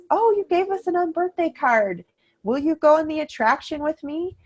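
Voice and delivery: high, sing-song Alice delight; delighted character voice. Retold or imitated. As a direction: voice the cast member touched by the card. Characters reading the child's card.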